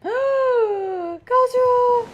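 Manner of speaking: very slow, high, loud wailing Kazuha; dramatic call. Acted. Fan finally finding Kazuha, wailing his name loud and long. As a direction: wail his name like a reunion.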